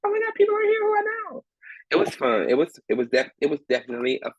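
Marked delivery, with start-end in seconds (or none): 0.1-1.4 high squeal of delight; excited. Retold or imitated. On stage spotting friends, high excited squeal. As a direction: gasp it, thrilled.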